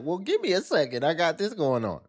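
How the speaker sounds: high, whiny stalling voice; mocking imitation. Cohost mimicking the guest's excuse, high whiny mock voice. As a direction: parody her excuse with a whine. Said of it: "it's like".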